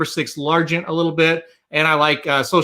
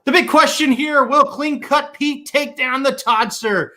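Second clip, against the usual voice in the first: Slim's announcer voice; 10 semitones higher than usual. Pretend. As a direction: hype it like a big-fight announcer. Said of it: "channeling my inner Slim with this announcer voice".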